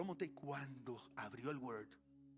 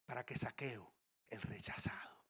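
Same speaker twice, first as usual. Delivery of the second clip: hushed, breathy whisper to the church; 4 semitones lower, 4 dB quieter than usual. Real, not acted. Pastor whispering to the congregation about Zacchaeus. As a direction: lean in and whisper it.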